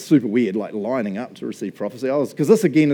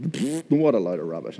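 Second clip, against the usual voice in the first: low scoff with a dismissive puff; retold inward sneer, kept fairly low; skeptical scoff; 4 semitones lower than usual. Skeptic in his head during the prophecy, scoffing. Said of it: "I'm negative and skeptical".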